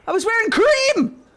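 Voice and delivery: high, loud, outraged cry of disbelief; indignant high yell. Retold or imitated. Telling chat about the goth accusation, high outraged yell. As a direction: shriek it in disbelief.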